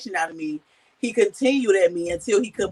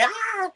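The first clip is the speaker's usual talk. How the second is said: short high imitated scream; a quick high little shriek; mock toddler scream; 13 semitones higher than usual. Mother imitating her three-year-old's angry scream, a short high shriek. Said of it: "you screaming".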